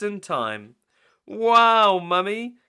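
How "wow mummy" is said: high, loud amazed wow; be dazzled; gasp of wonder. Acted. Peppa amazed at Mummy's catch, high and loud. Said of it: "gasped Peppa".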